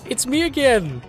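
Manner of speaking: high, loud announcement of yourself; hammed-up, high-pitched excited shout; mock excited reveal. Staged reveal before the sign-off.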